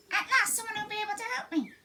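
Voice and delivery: fast, high hopeful mole; a relieved little mole. Acted. Mole hoping the flies will help, high and fast. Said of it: "thought the mole".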